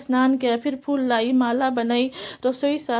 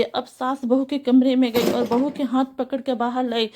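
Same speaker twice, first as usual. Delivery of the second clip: usual speaking voice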